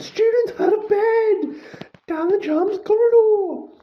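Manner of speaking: screeching alarm call, high and loud; bellowing. A narrator's reading. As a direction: shout it down the corridor for everyone. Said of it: "Peeves bellowed".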